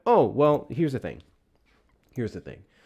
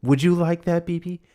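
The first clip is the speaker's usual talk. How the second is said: mock cooing offer; 7 semitones higher, 4 dB louder than usual. Mocking fawning senator.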